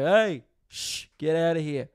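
high, loud shooing voice; hammed-up boss shooing the doctor; imitating neighbour. Neighbour loudly shooing the doctor away. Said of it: "he was just like".

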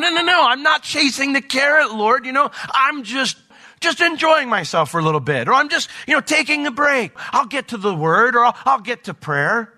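high-pitched, hurried-sounding self-justification; mock protesting excuses. Acts making excuses to the Lord.

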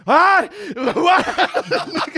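loud, high yelp over headphones; oblivious high-pitched "what?"; deaf shout. Retold or imitated. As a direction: yell it like you can't hear yourself. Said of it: "Am I in a camp for the deaf?".

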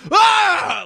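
loud, high-pitched imitation yell; sudden wordless mock scream. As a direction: burst out yelling like a TV chef. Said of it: "just start yelling at you".

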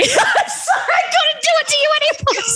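high, breathless laughing sorry; laughing apology. Real, not acted. Owning up to friend Amy about the prank, laughing. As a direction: giggle through the apologies.

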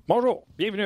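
bright rising high greeting; upbeat announcer hello. Real, not acted. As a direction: launch the show with energy. Restarting the show intro.

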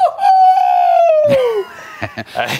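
high shrieking burst of laughter. Real, not acted. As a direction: let out a loud, high squeal of a laugh. Surprise guest bursts in.

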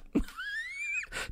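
shrieking burst of laughter; high squealing laugh. Real, not acted. Big laugh.

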